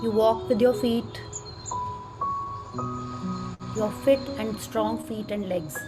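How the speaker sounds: soft, slow meditation voice; calmly walk them through gratitude; guided meditation. Acted.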